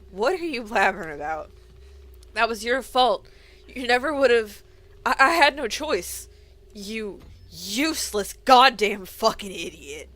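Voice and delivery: loud, raging accusation; furious yelling. Acted. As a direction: scream the blame at him. Furious blame quarrel.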